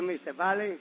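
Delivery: ordinary delivery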